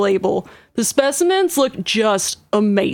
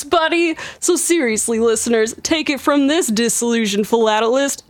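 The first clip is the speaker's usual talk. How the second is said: ordinary talk, nothing marked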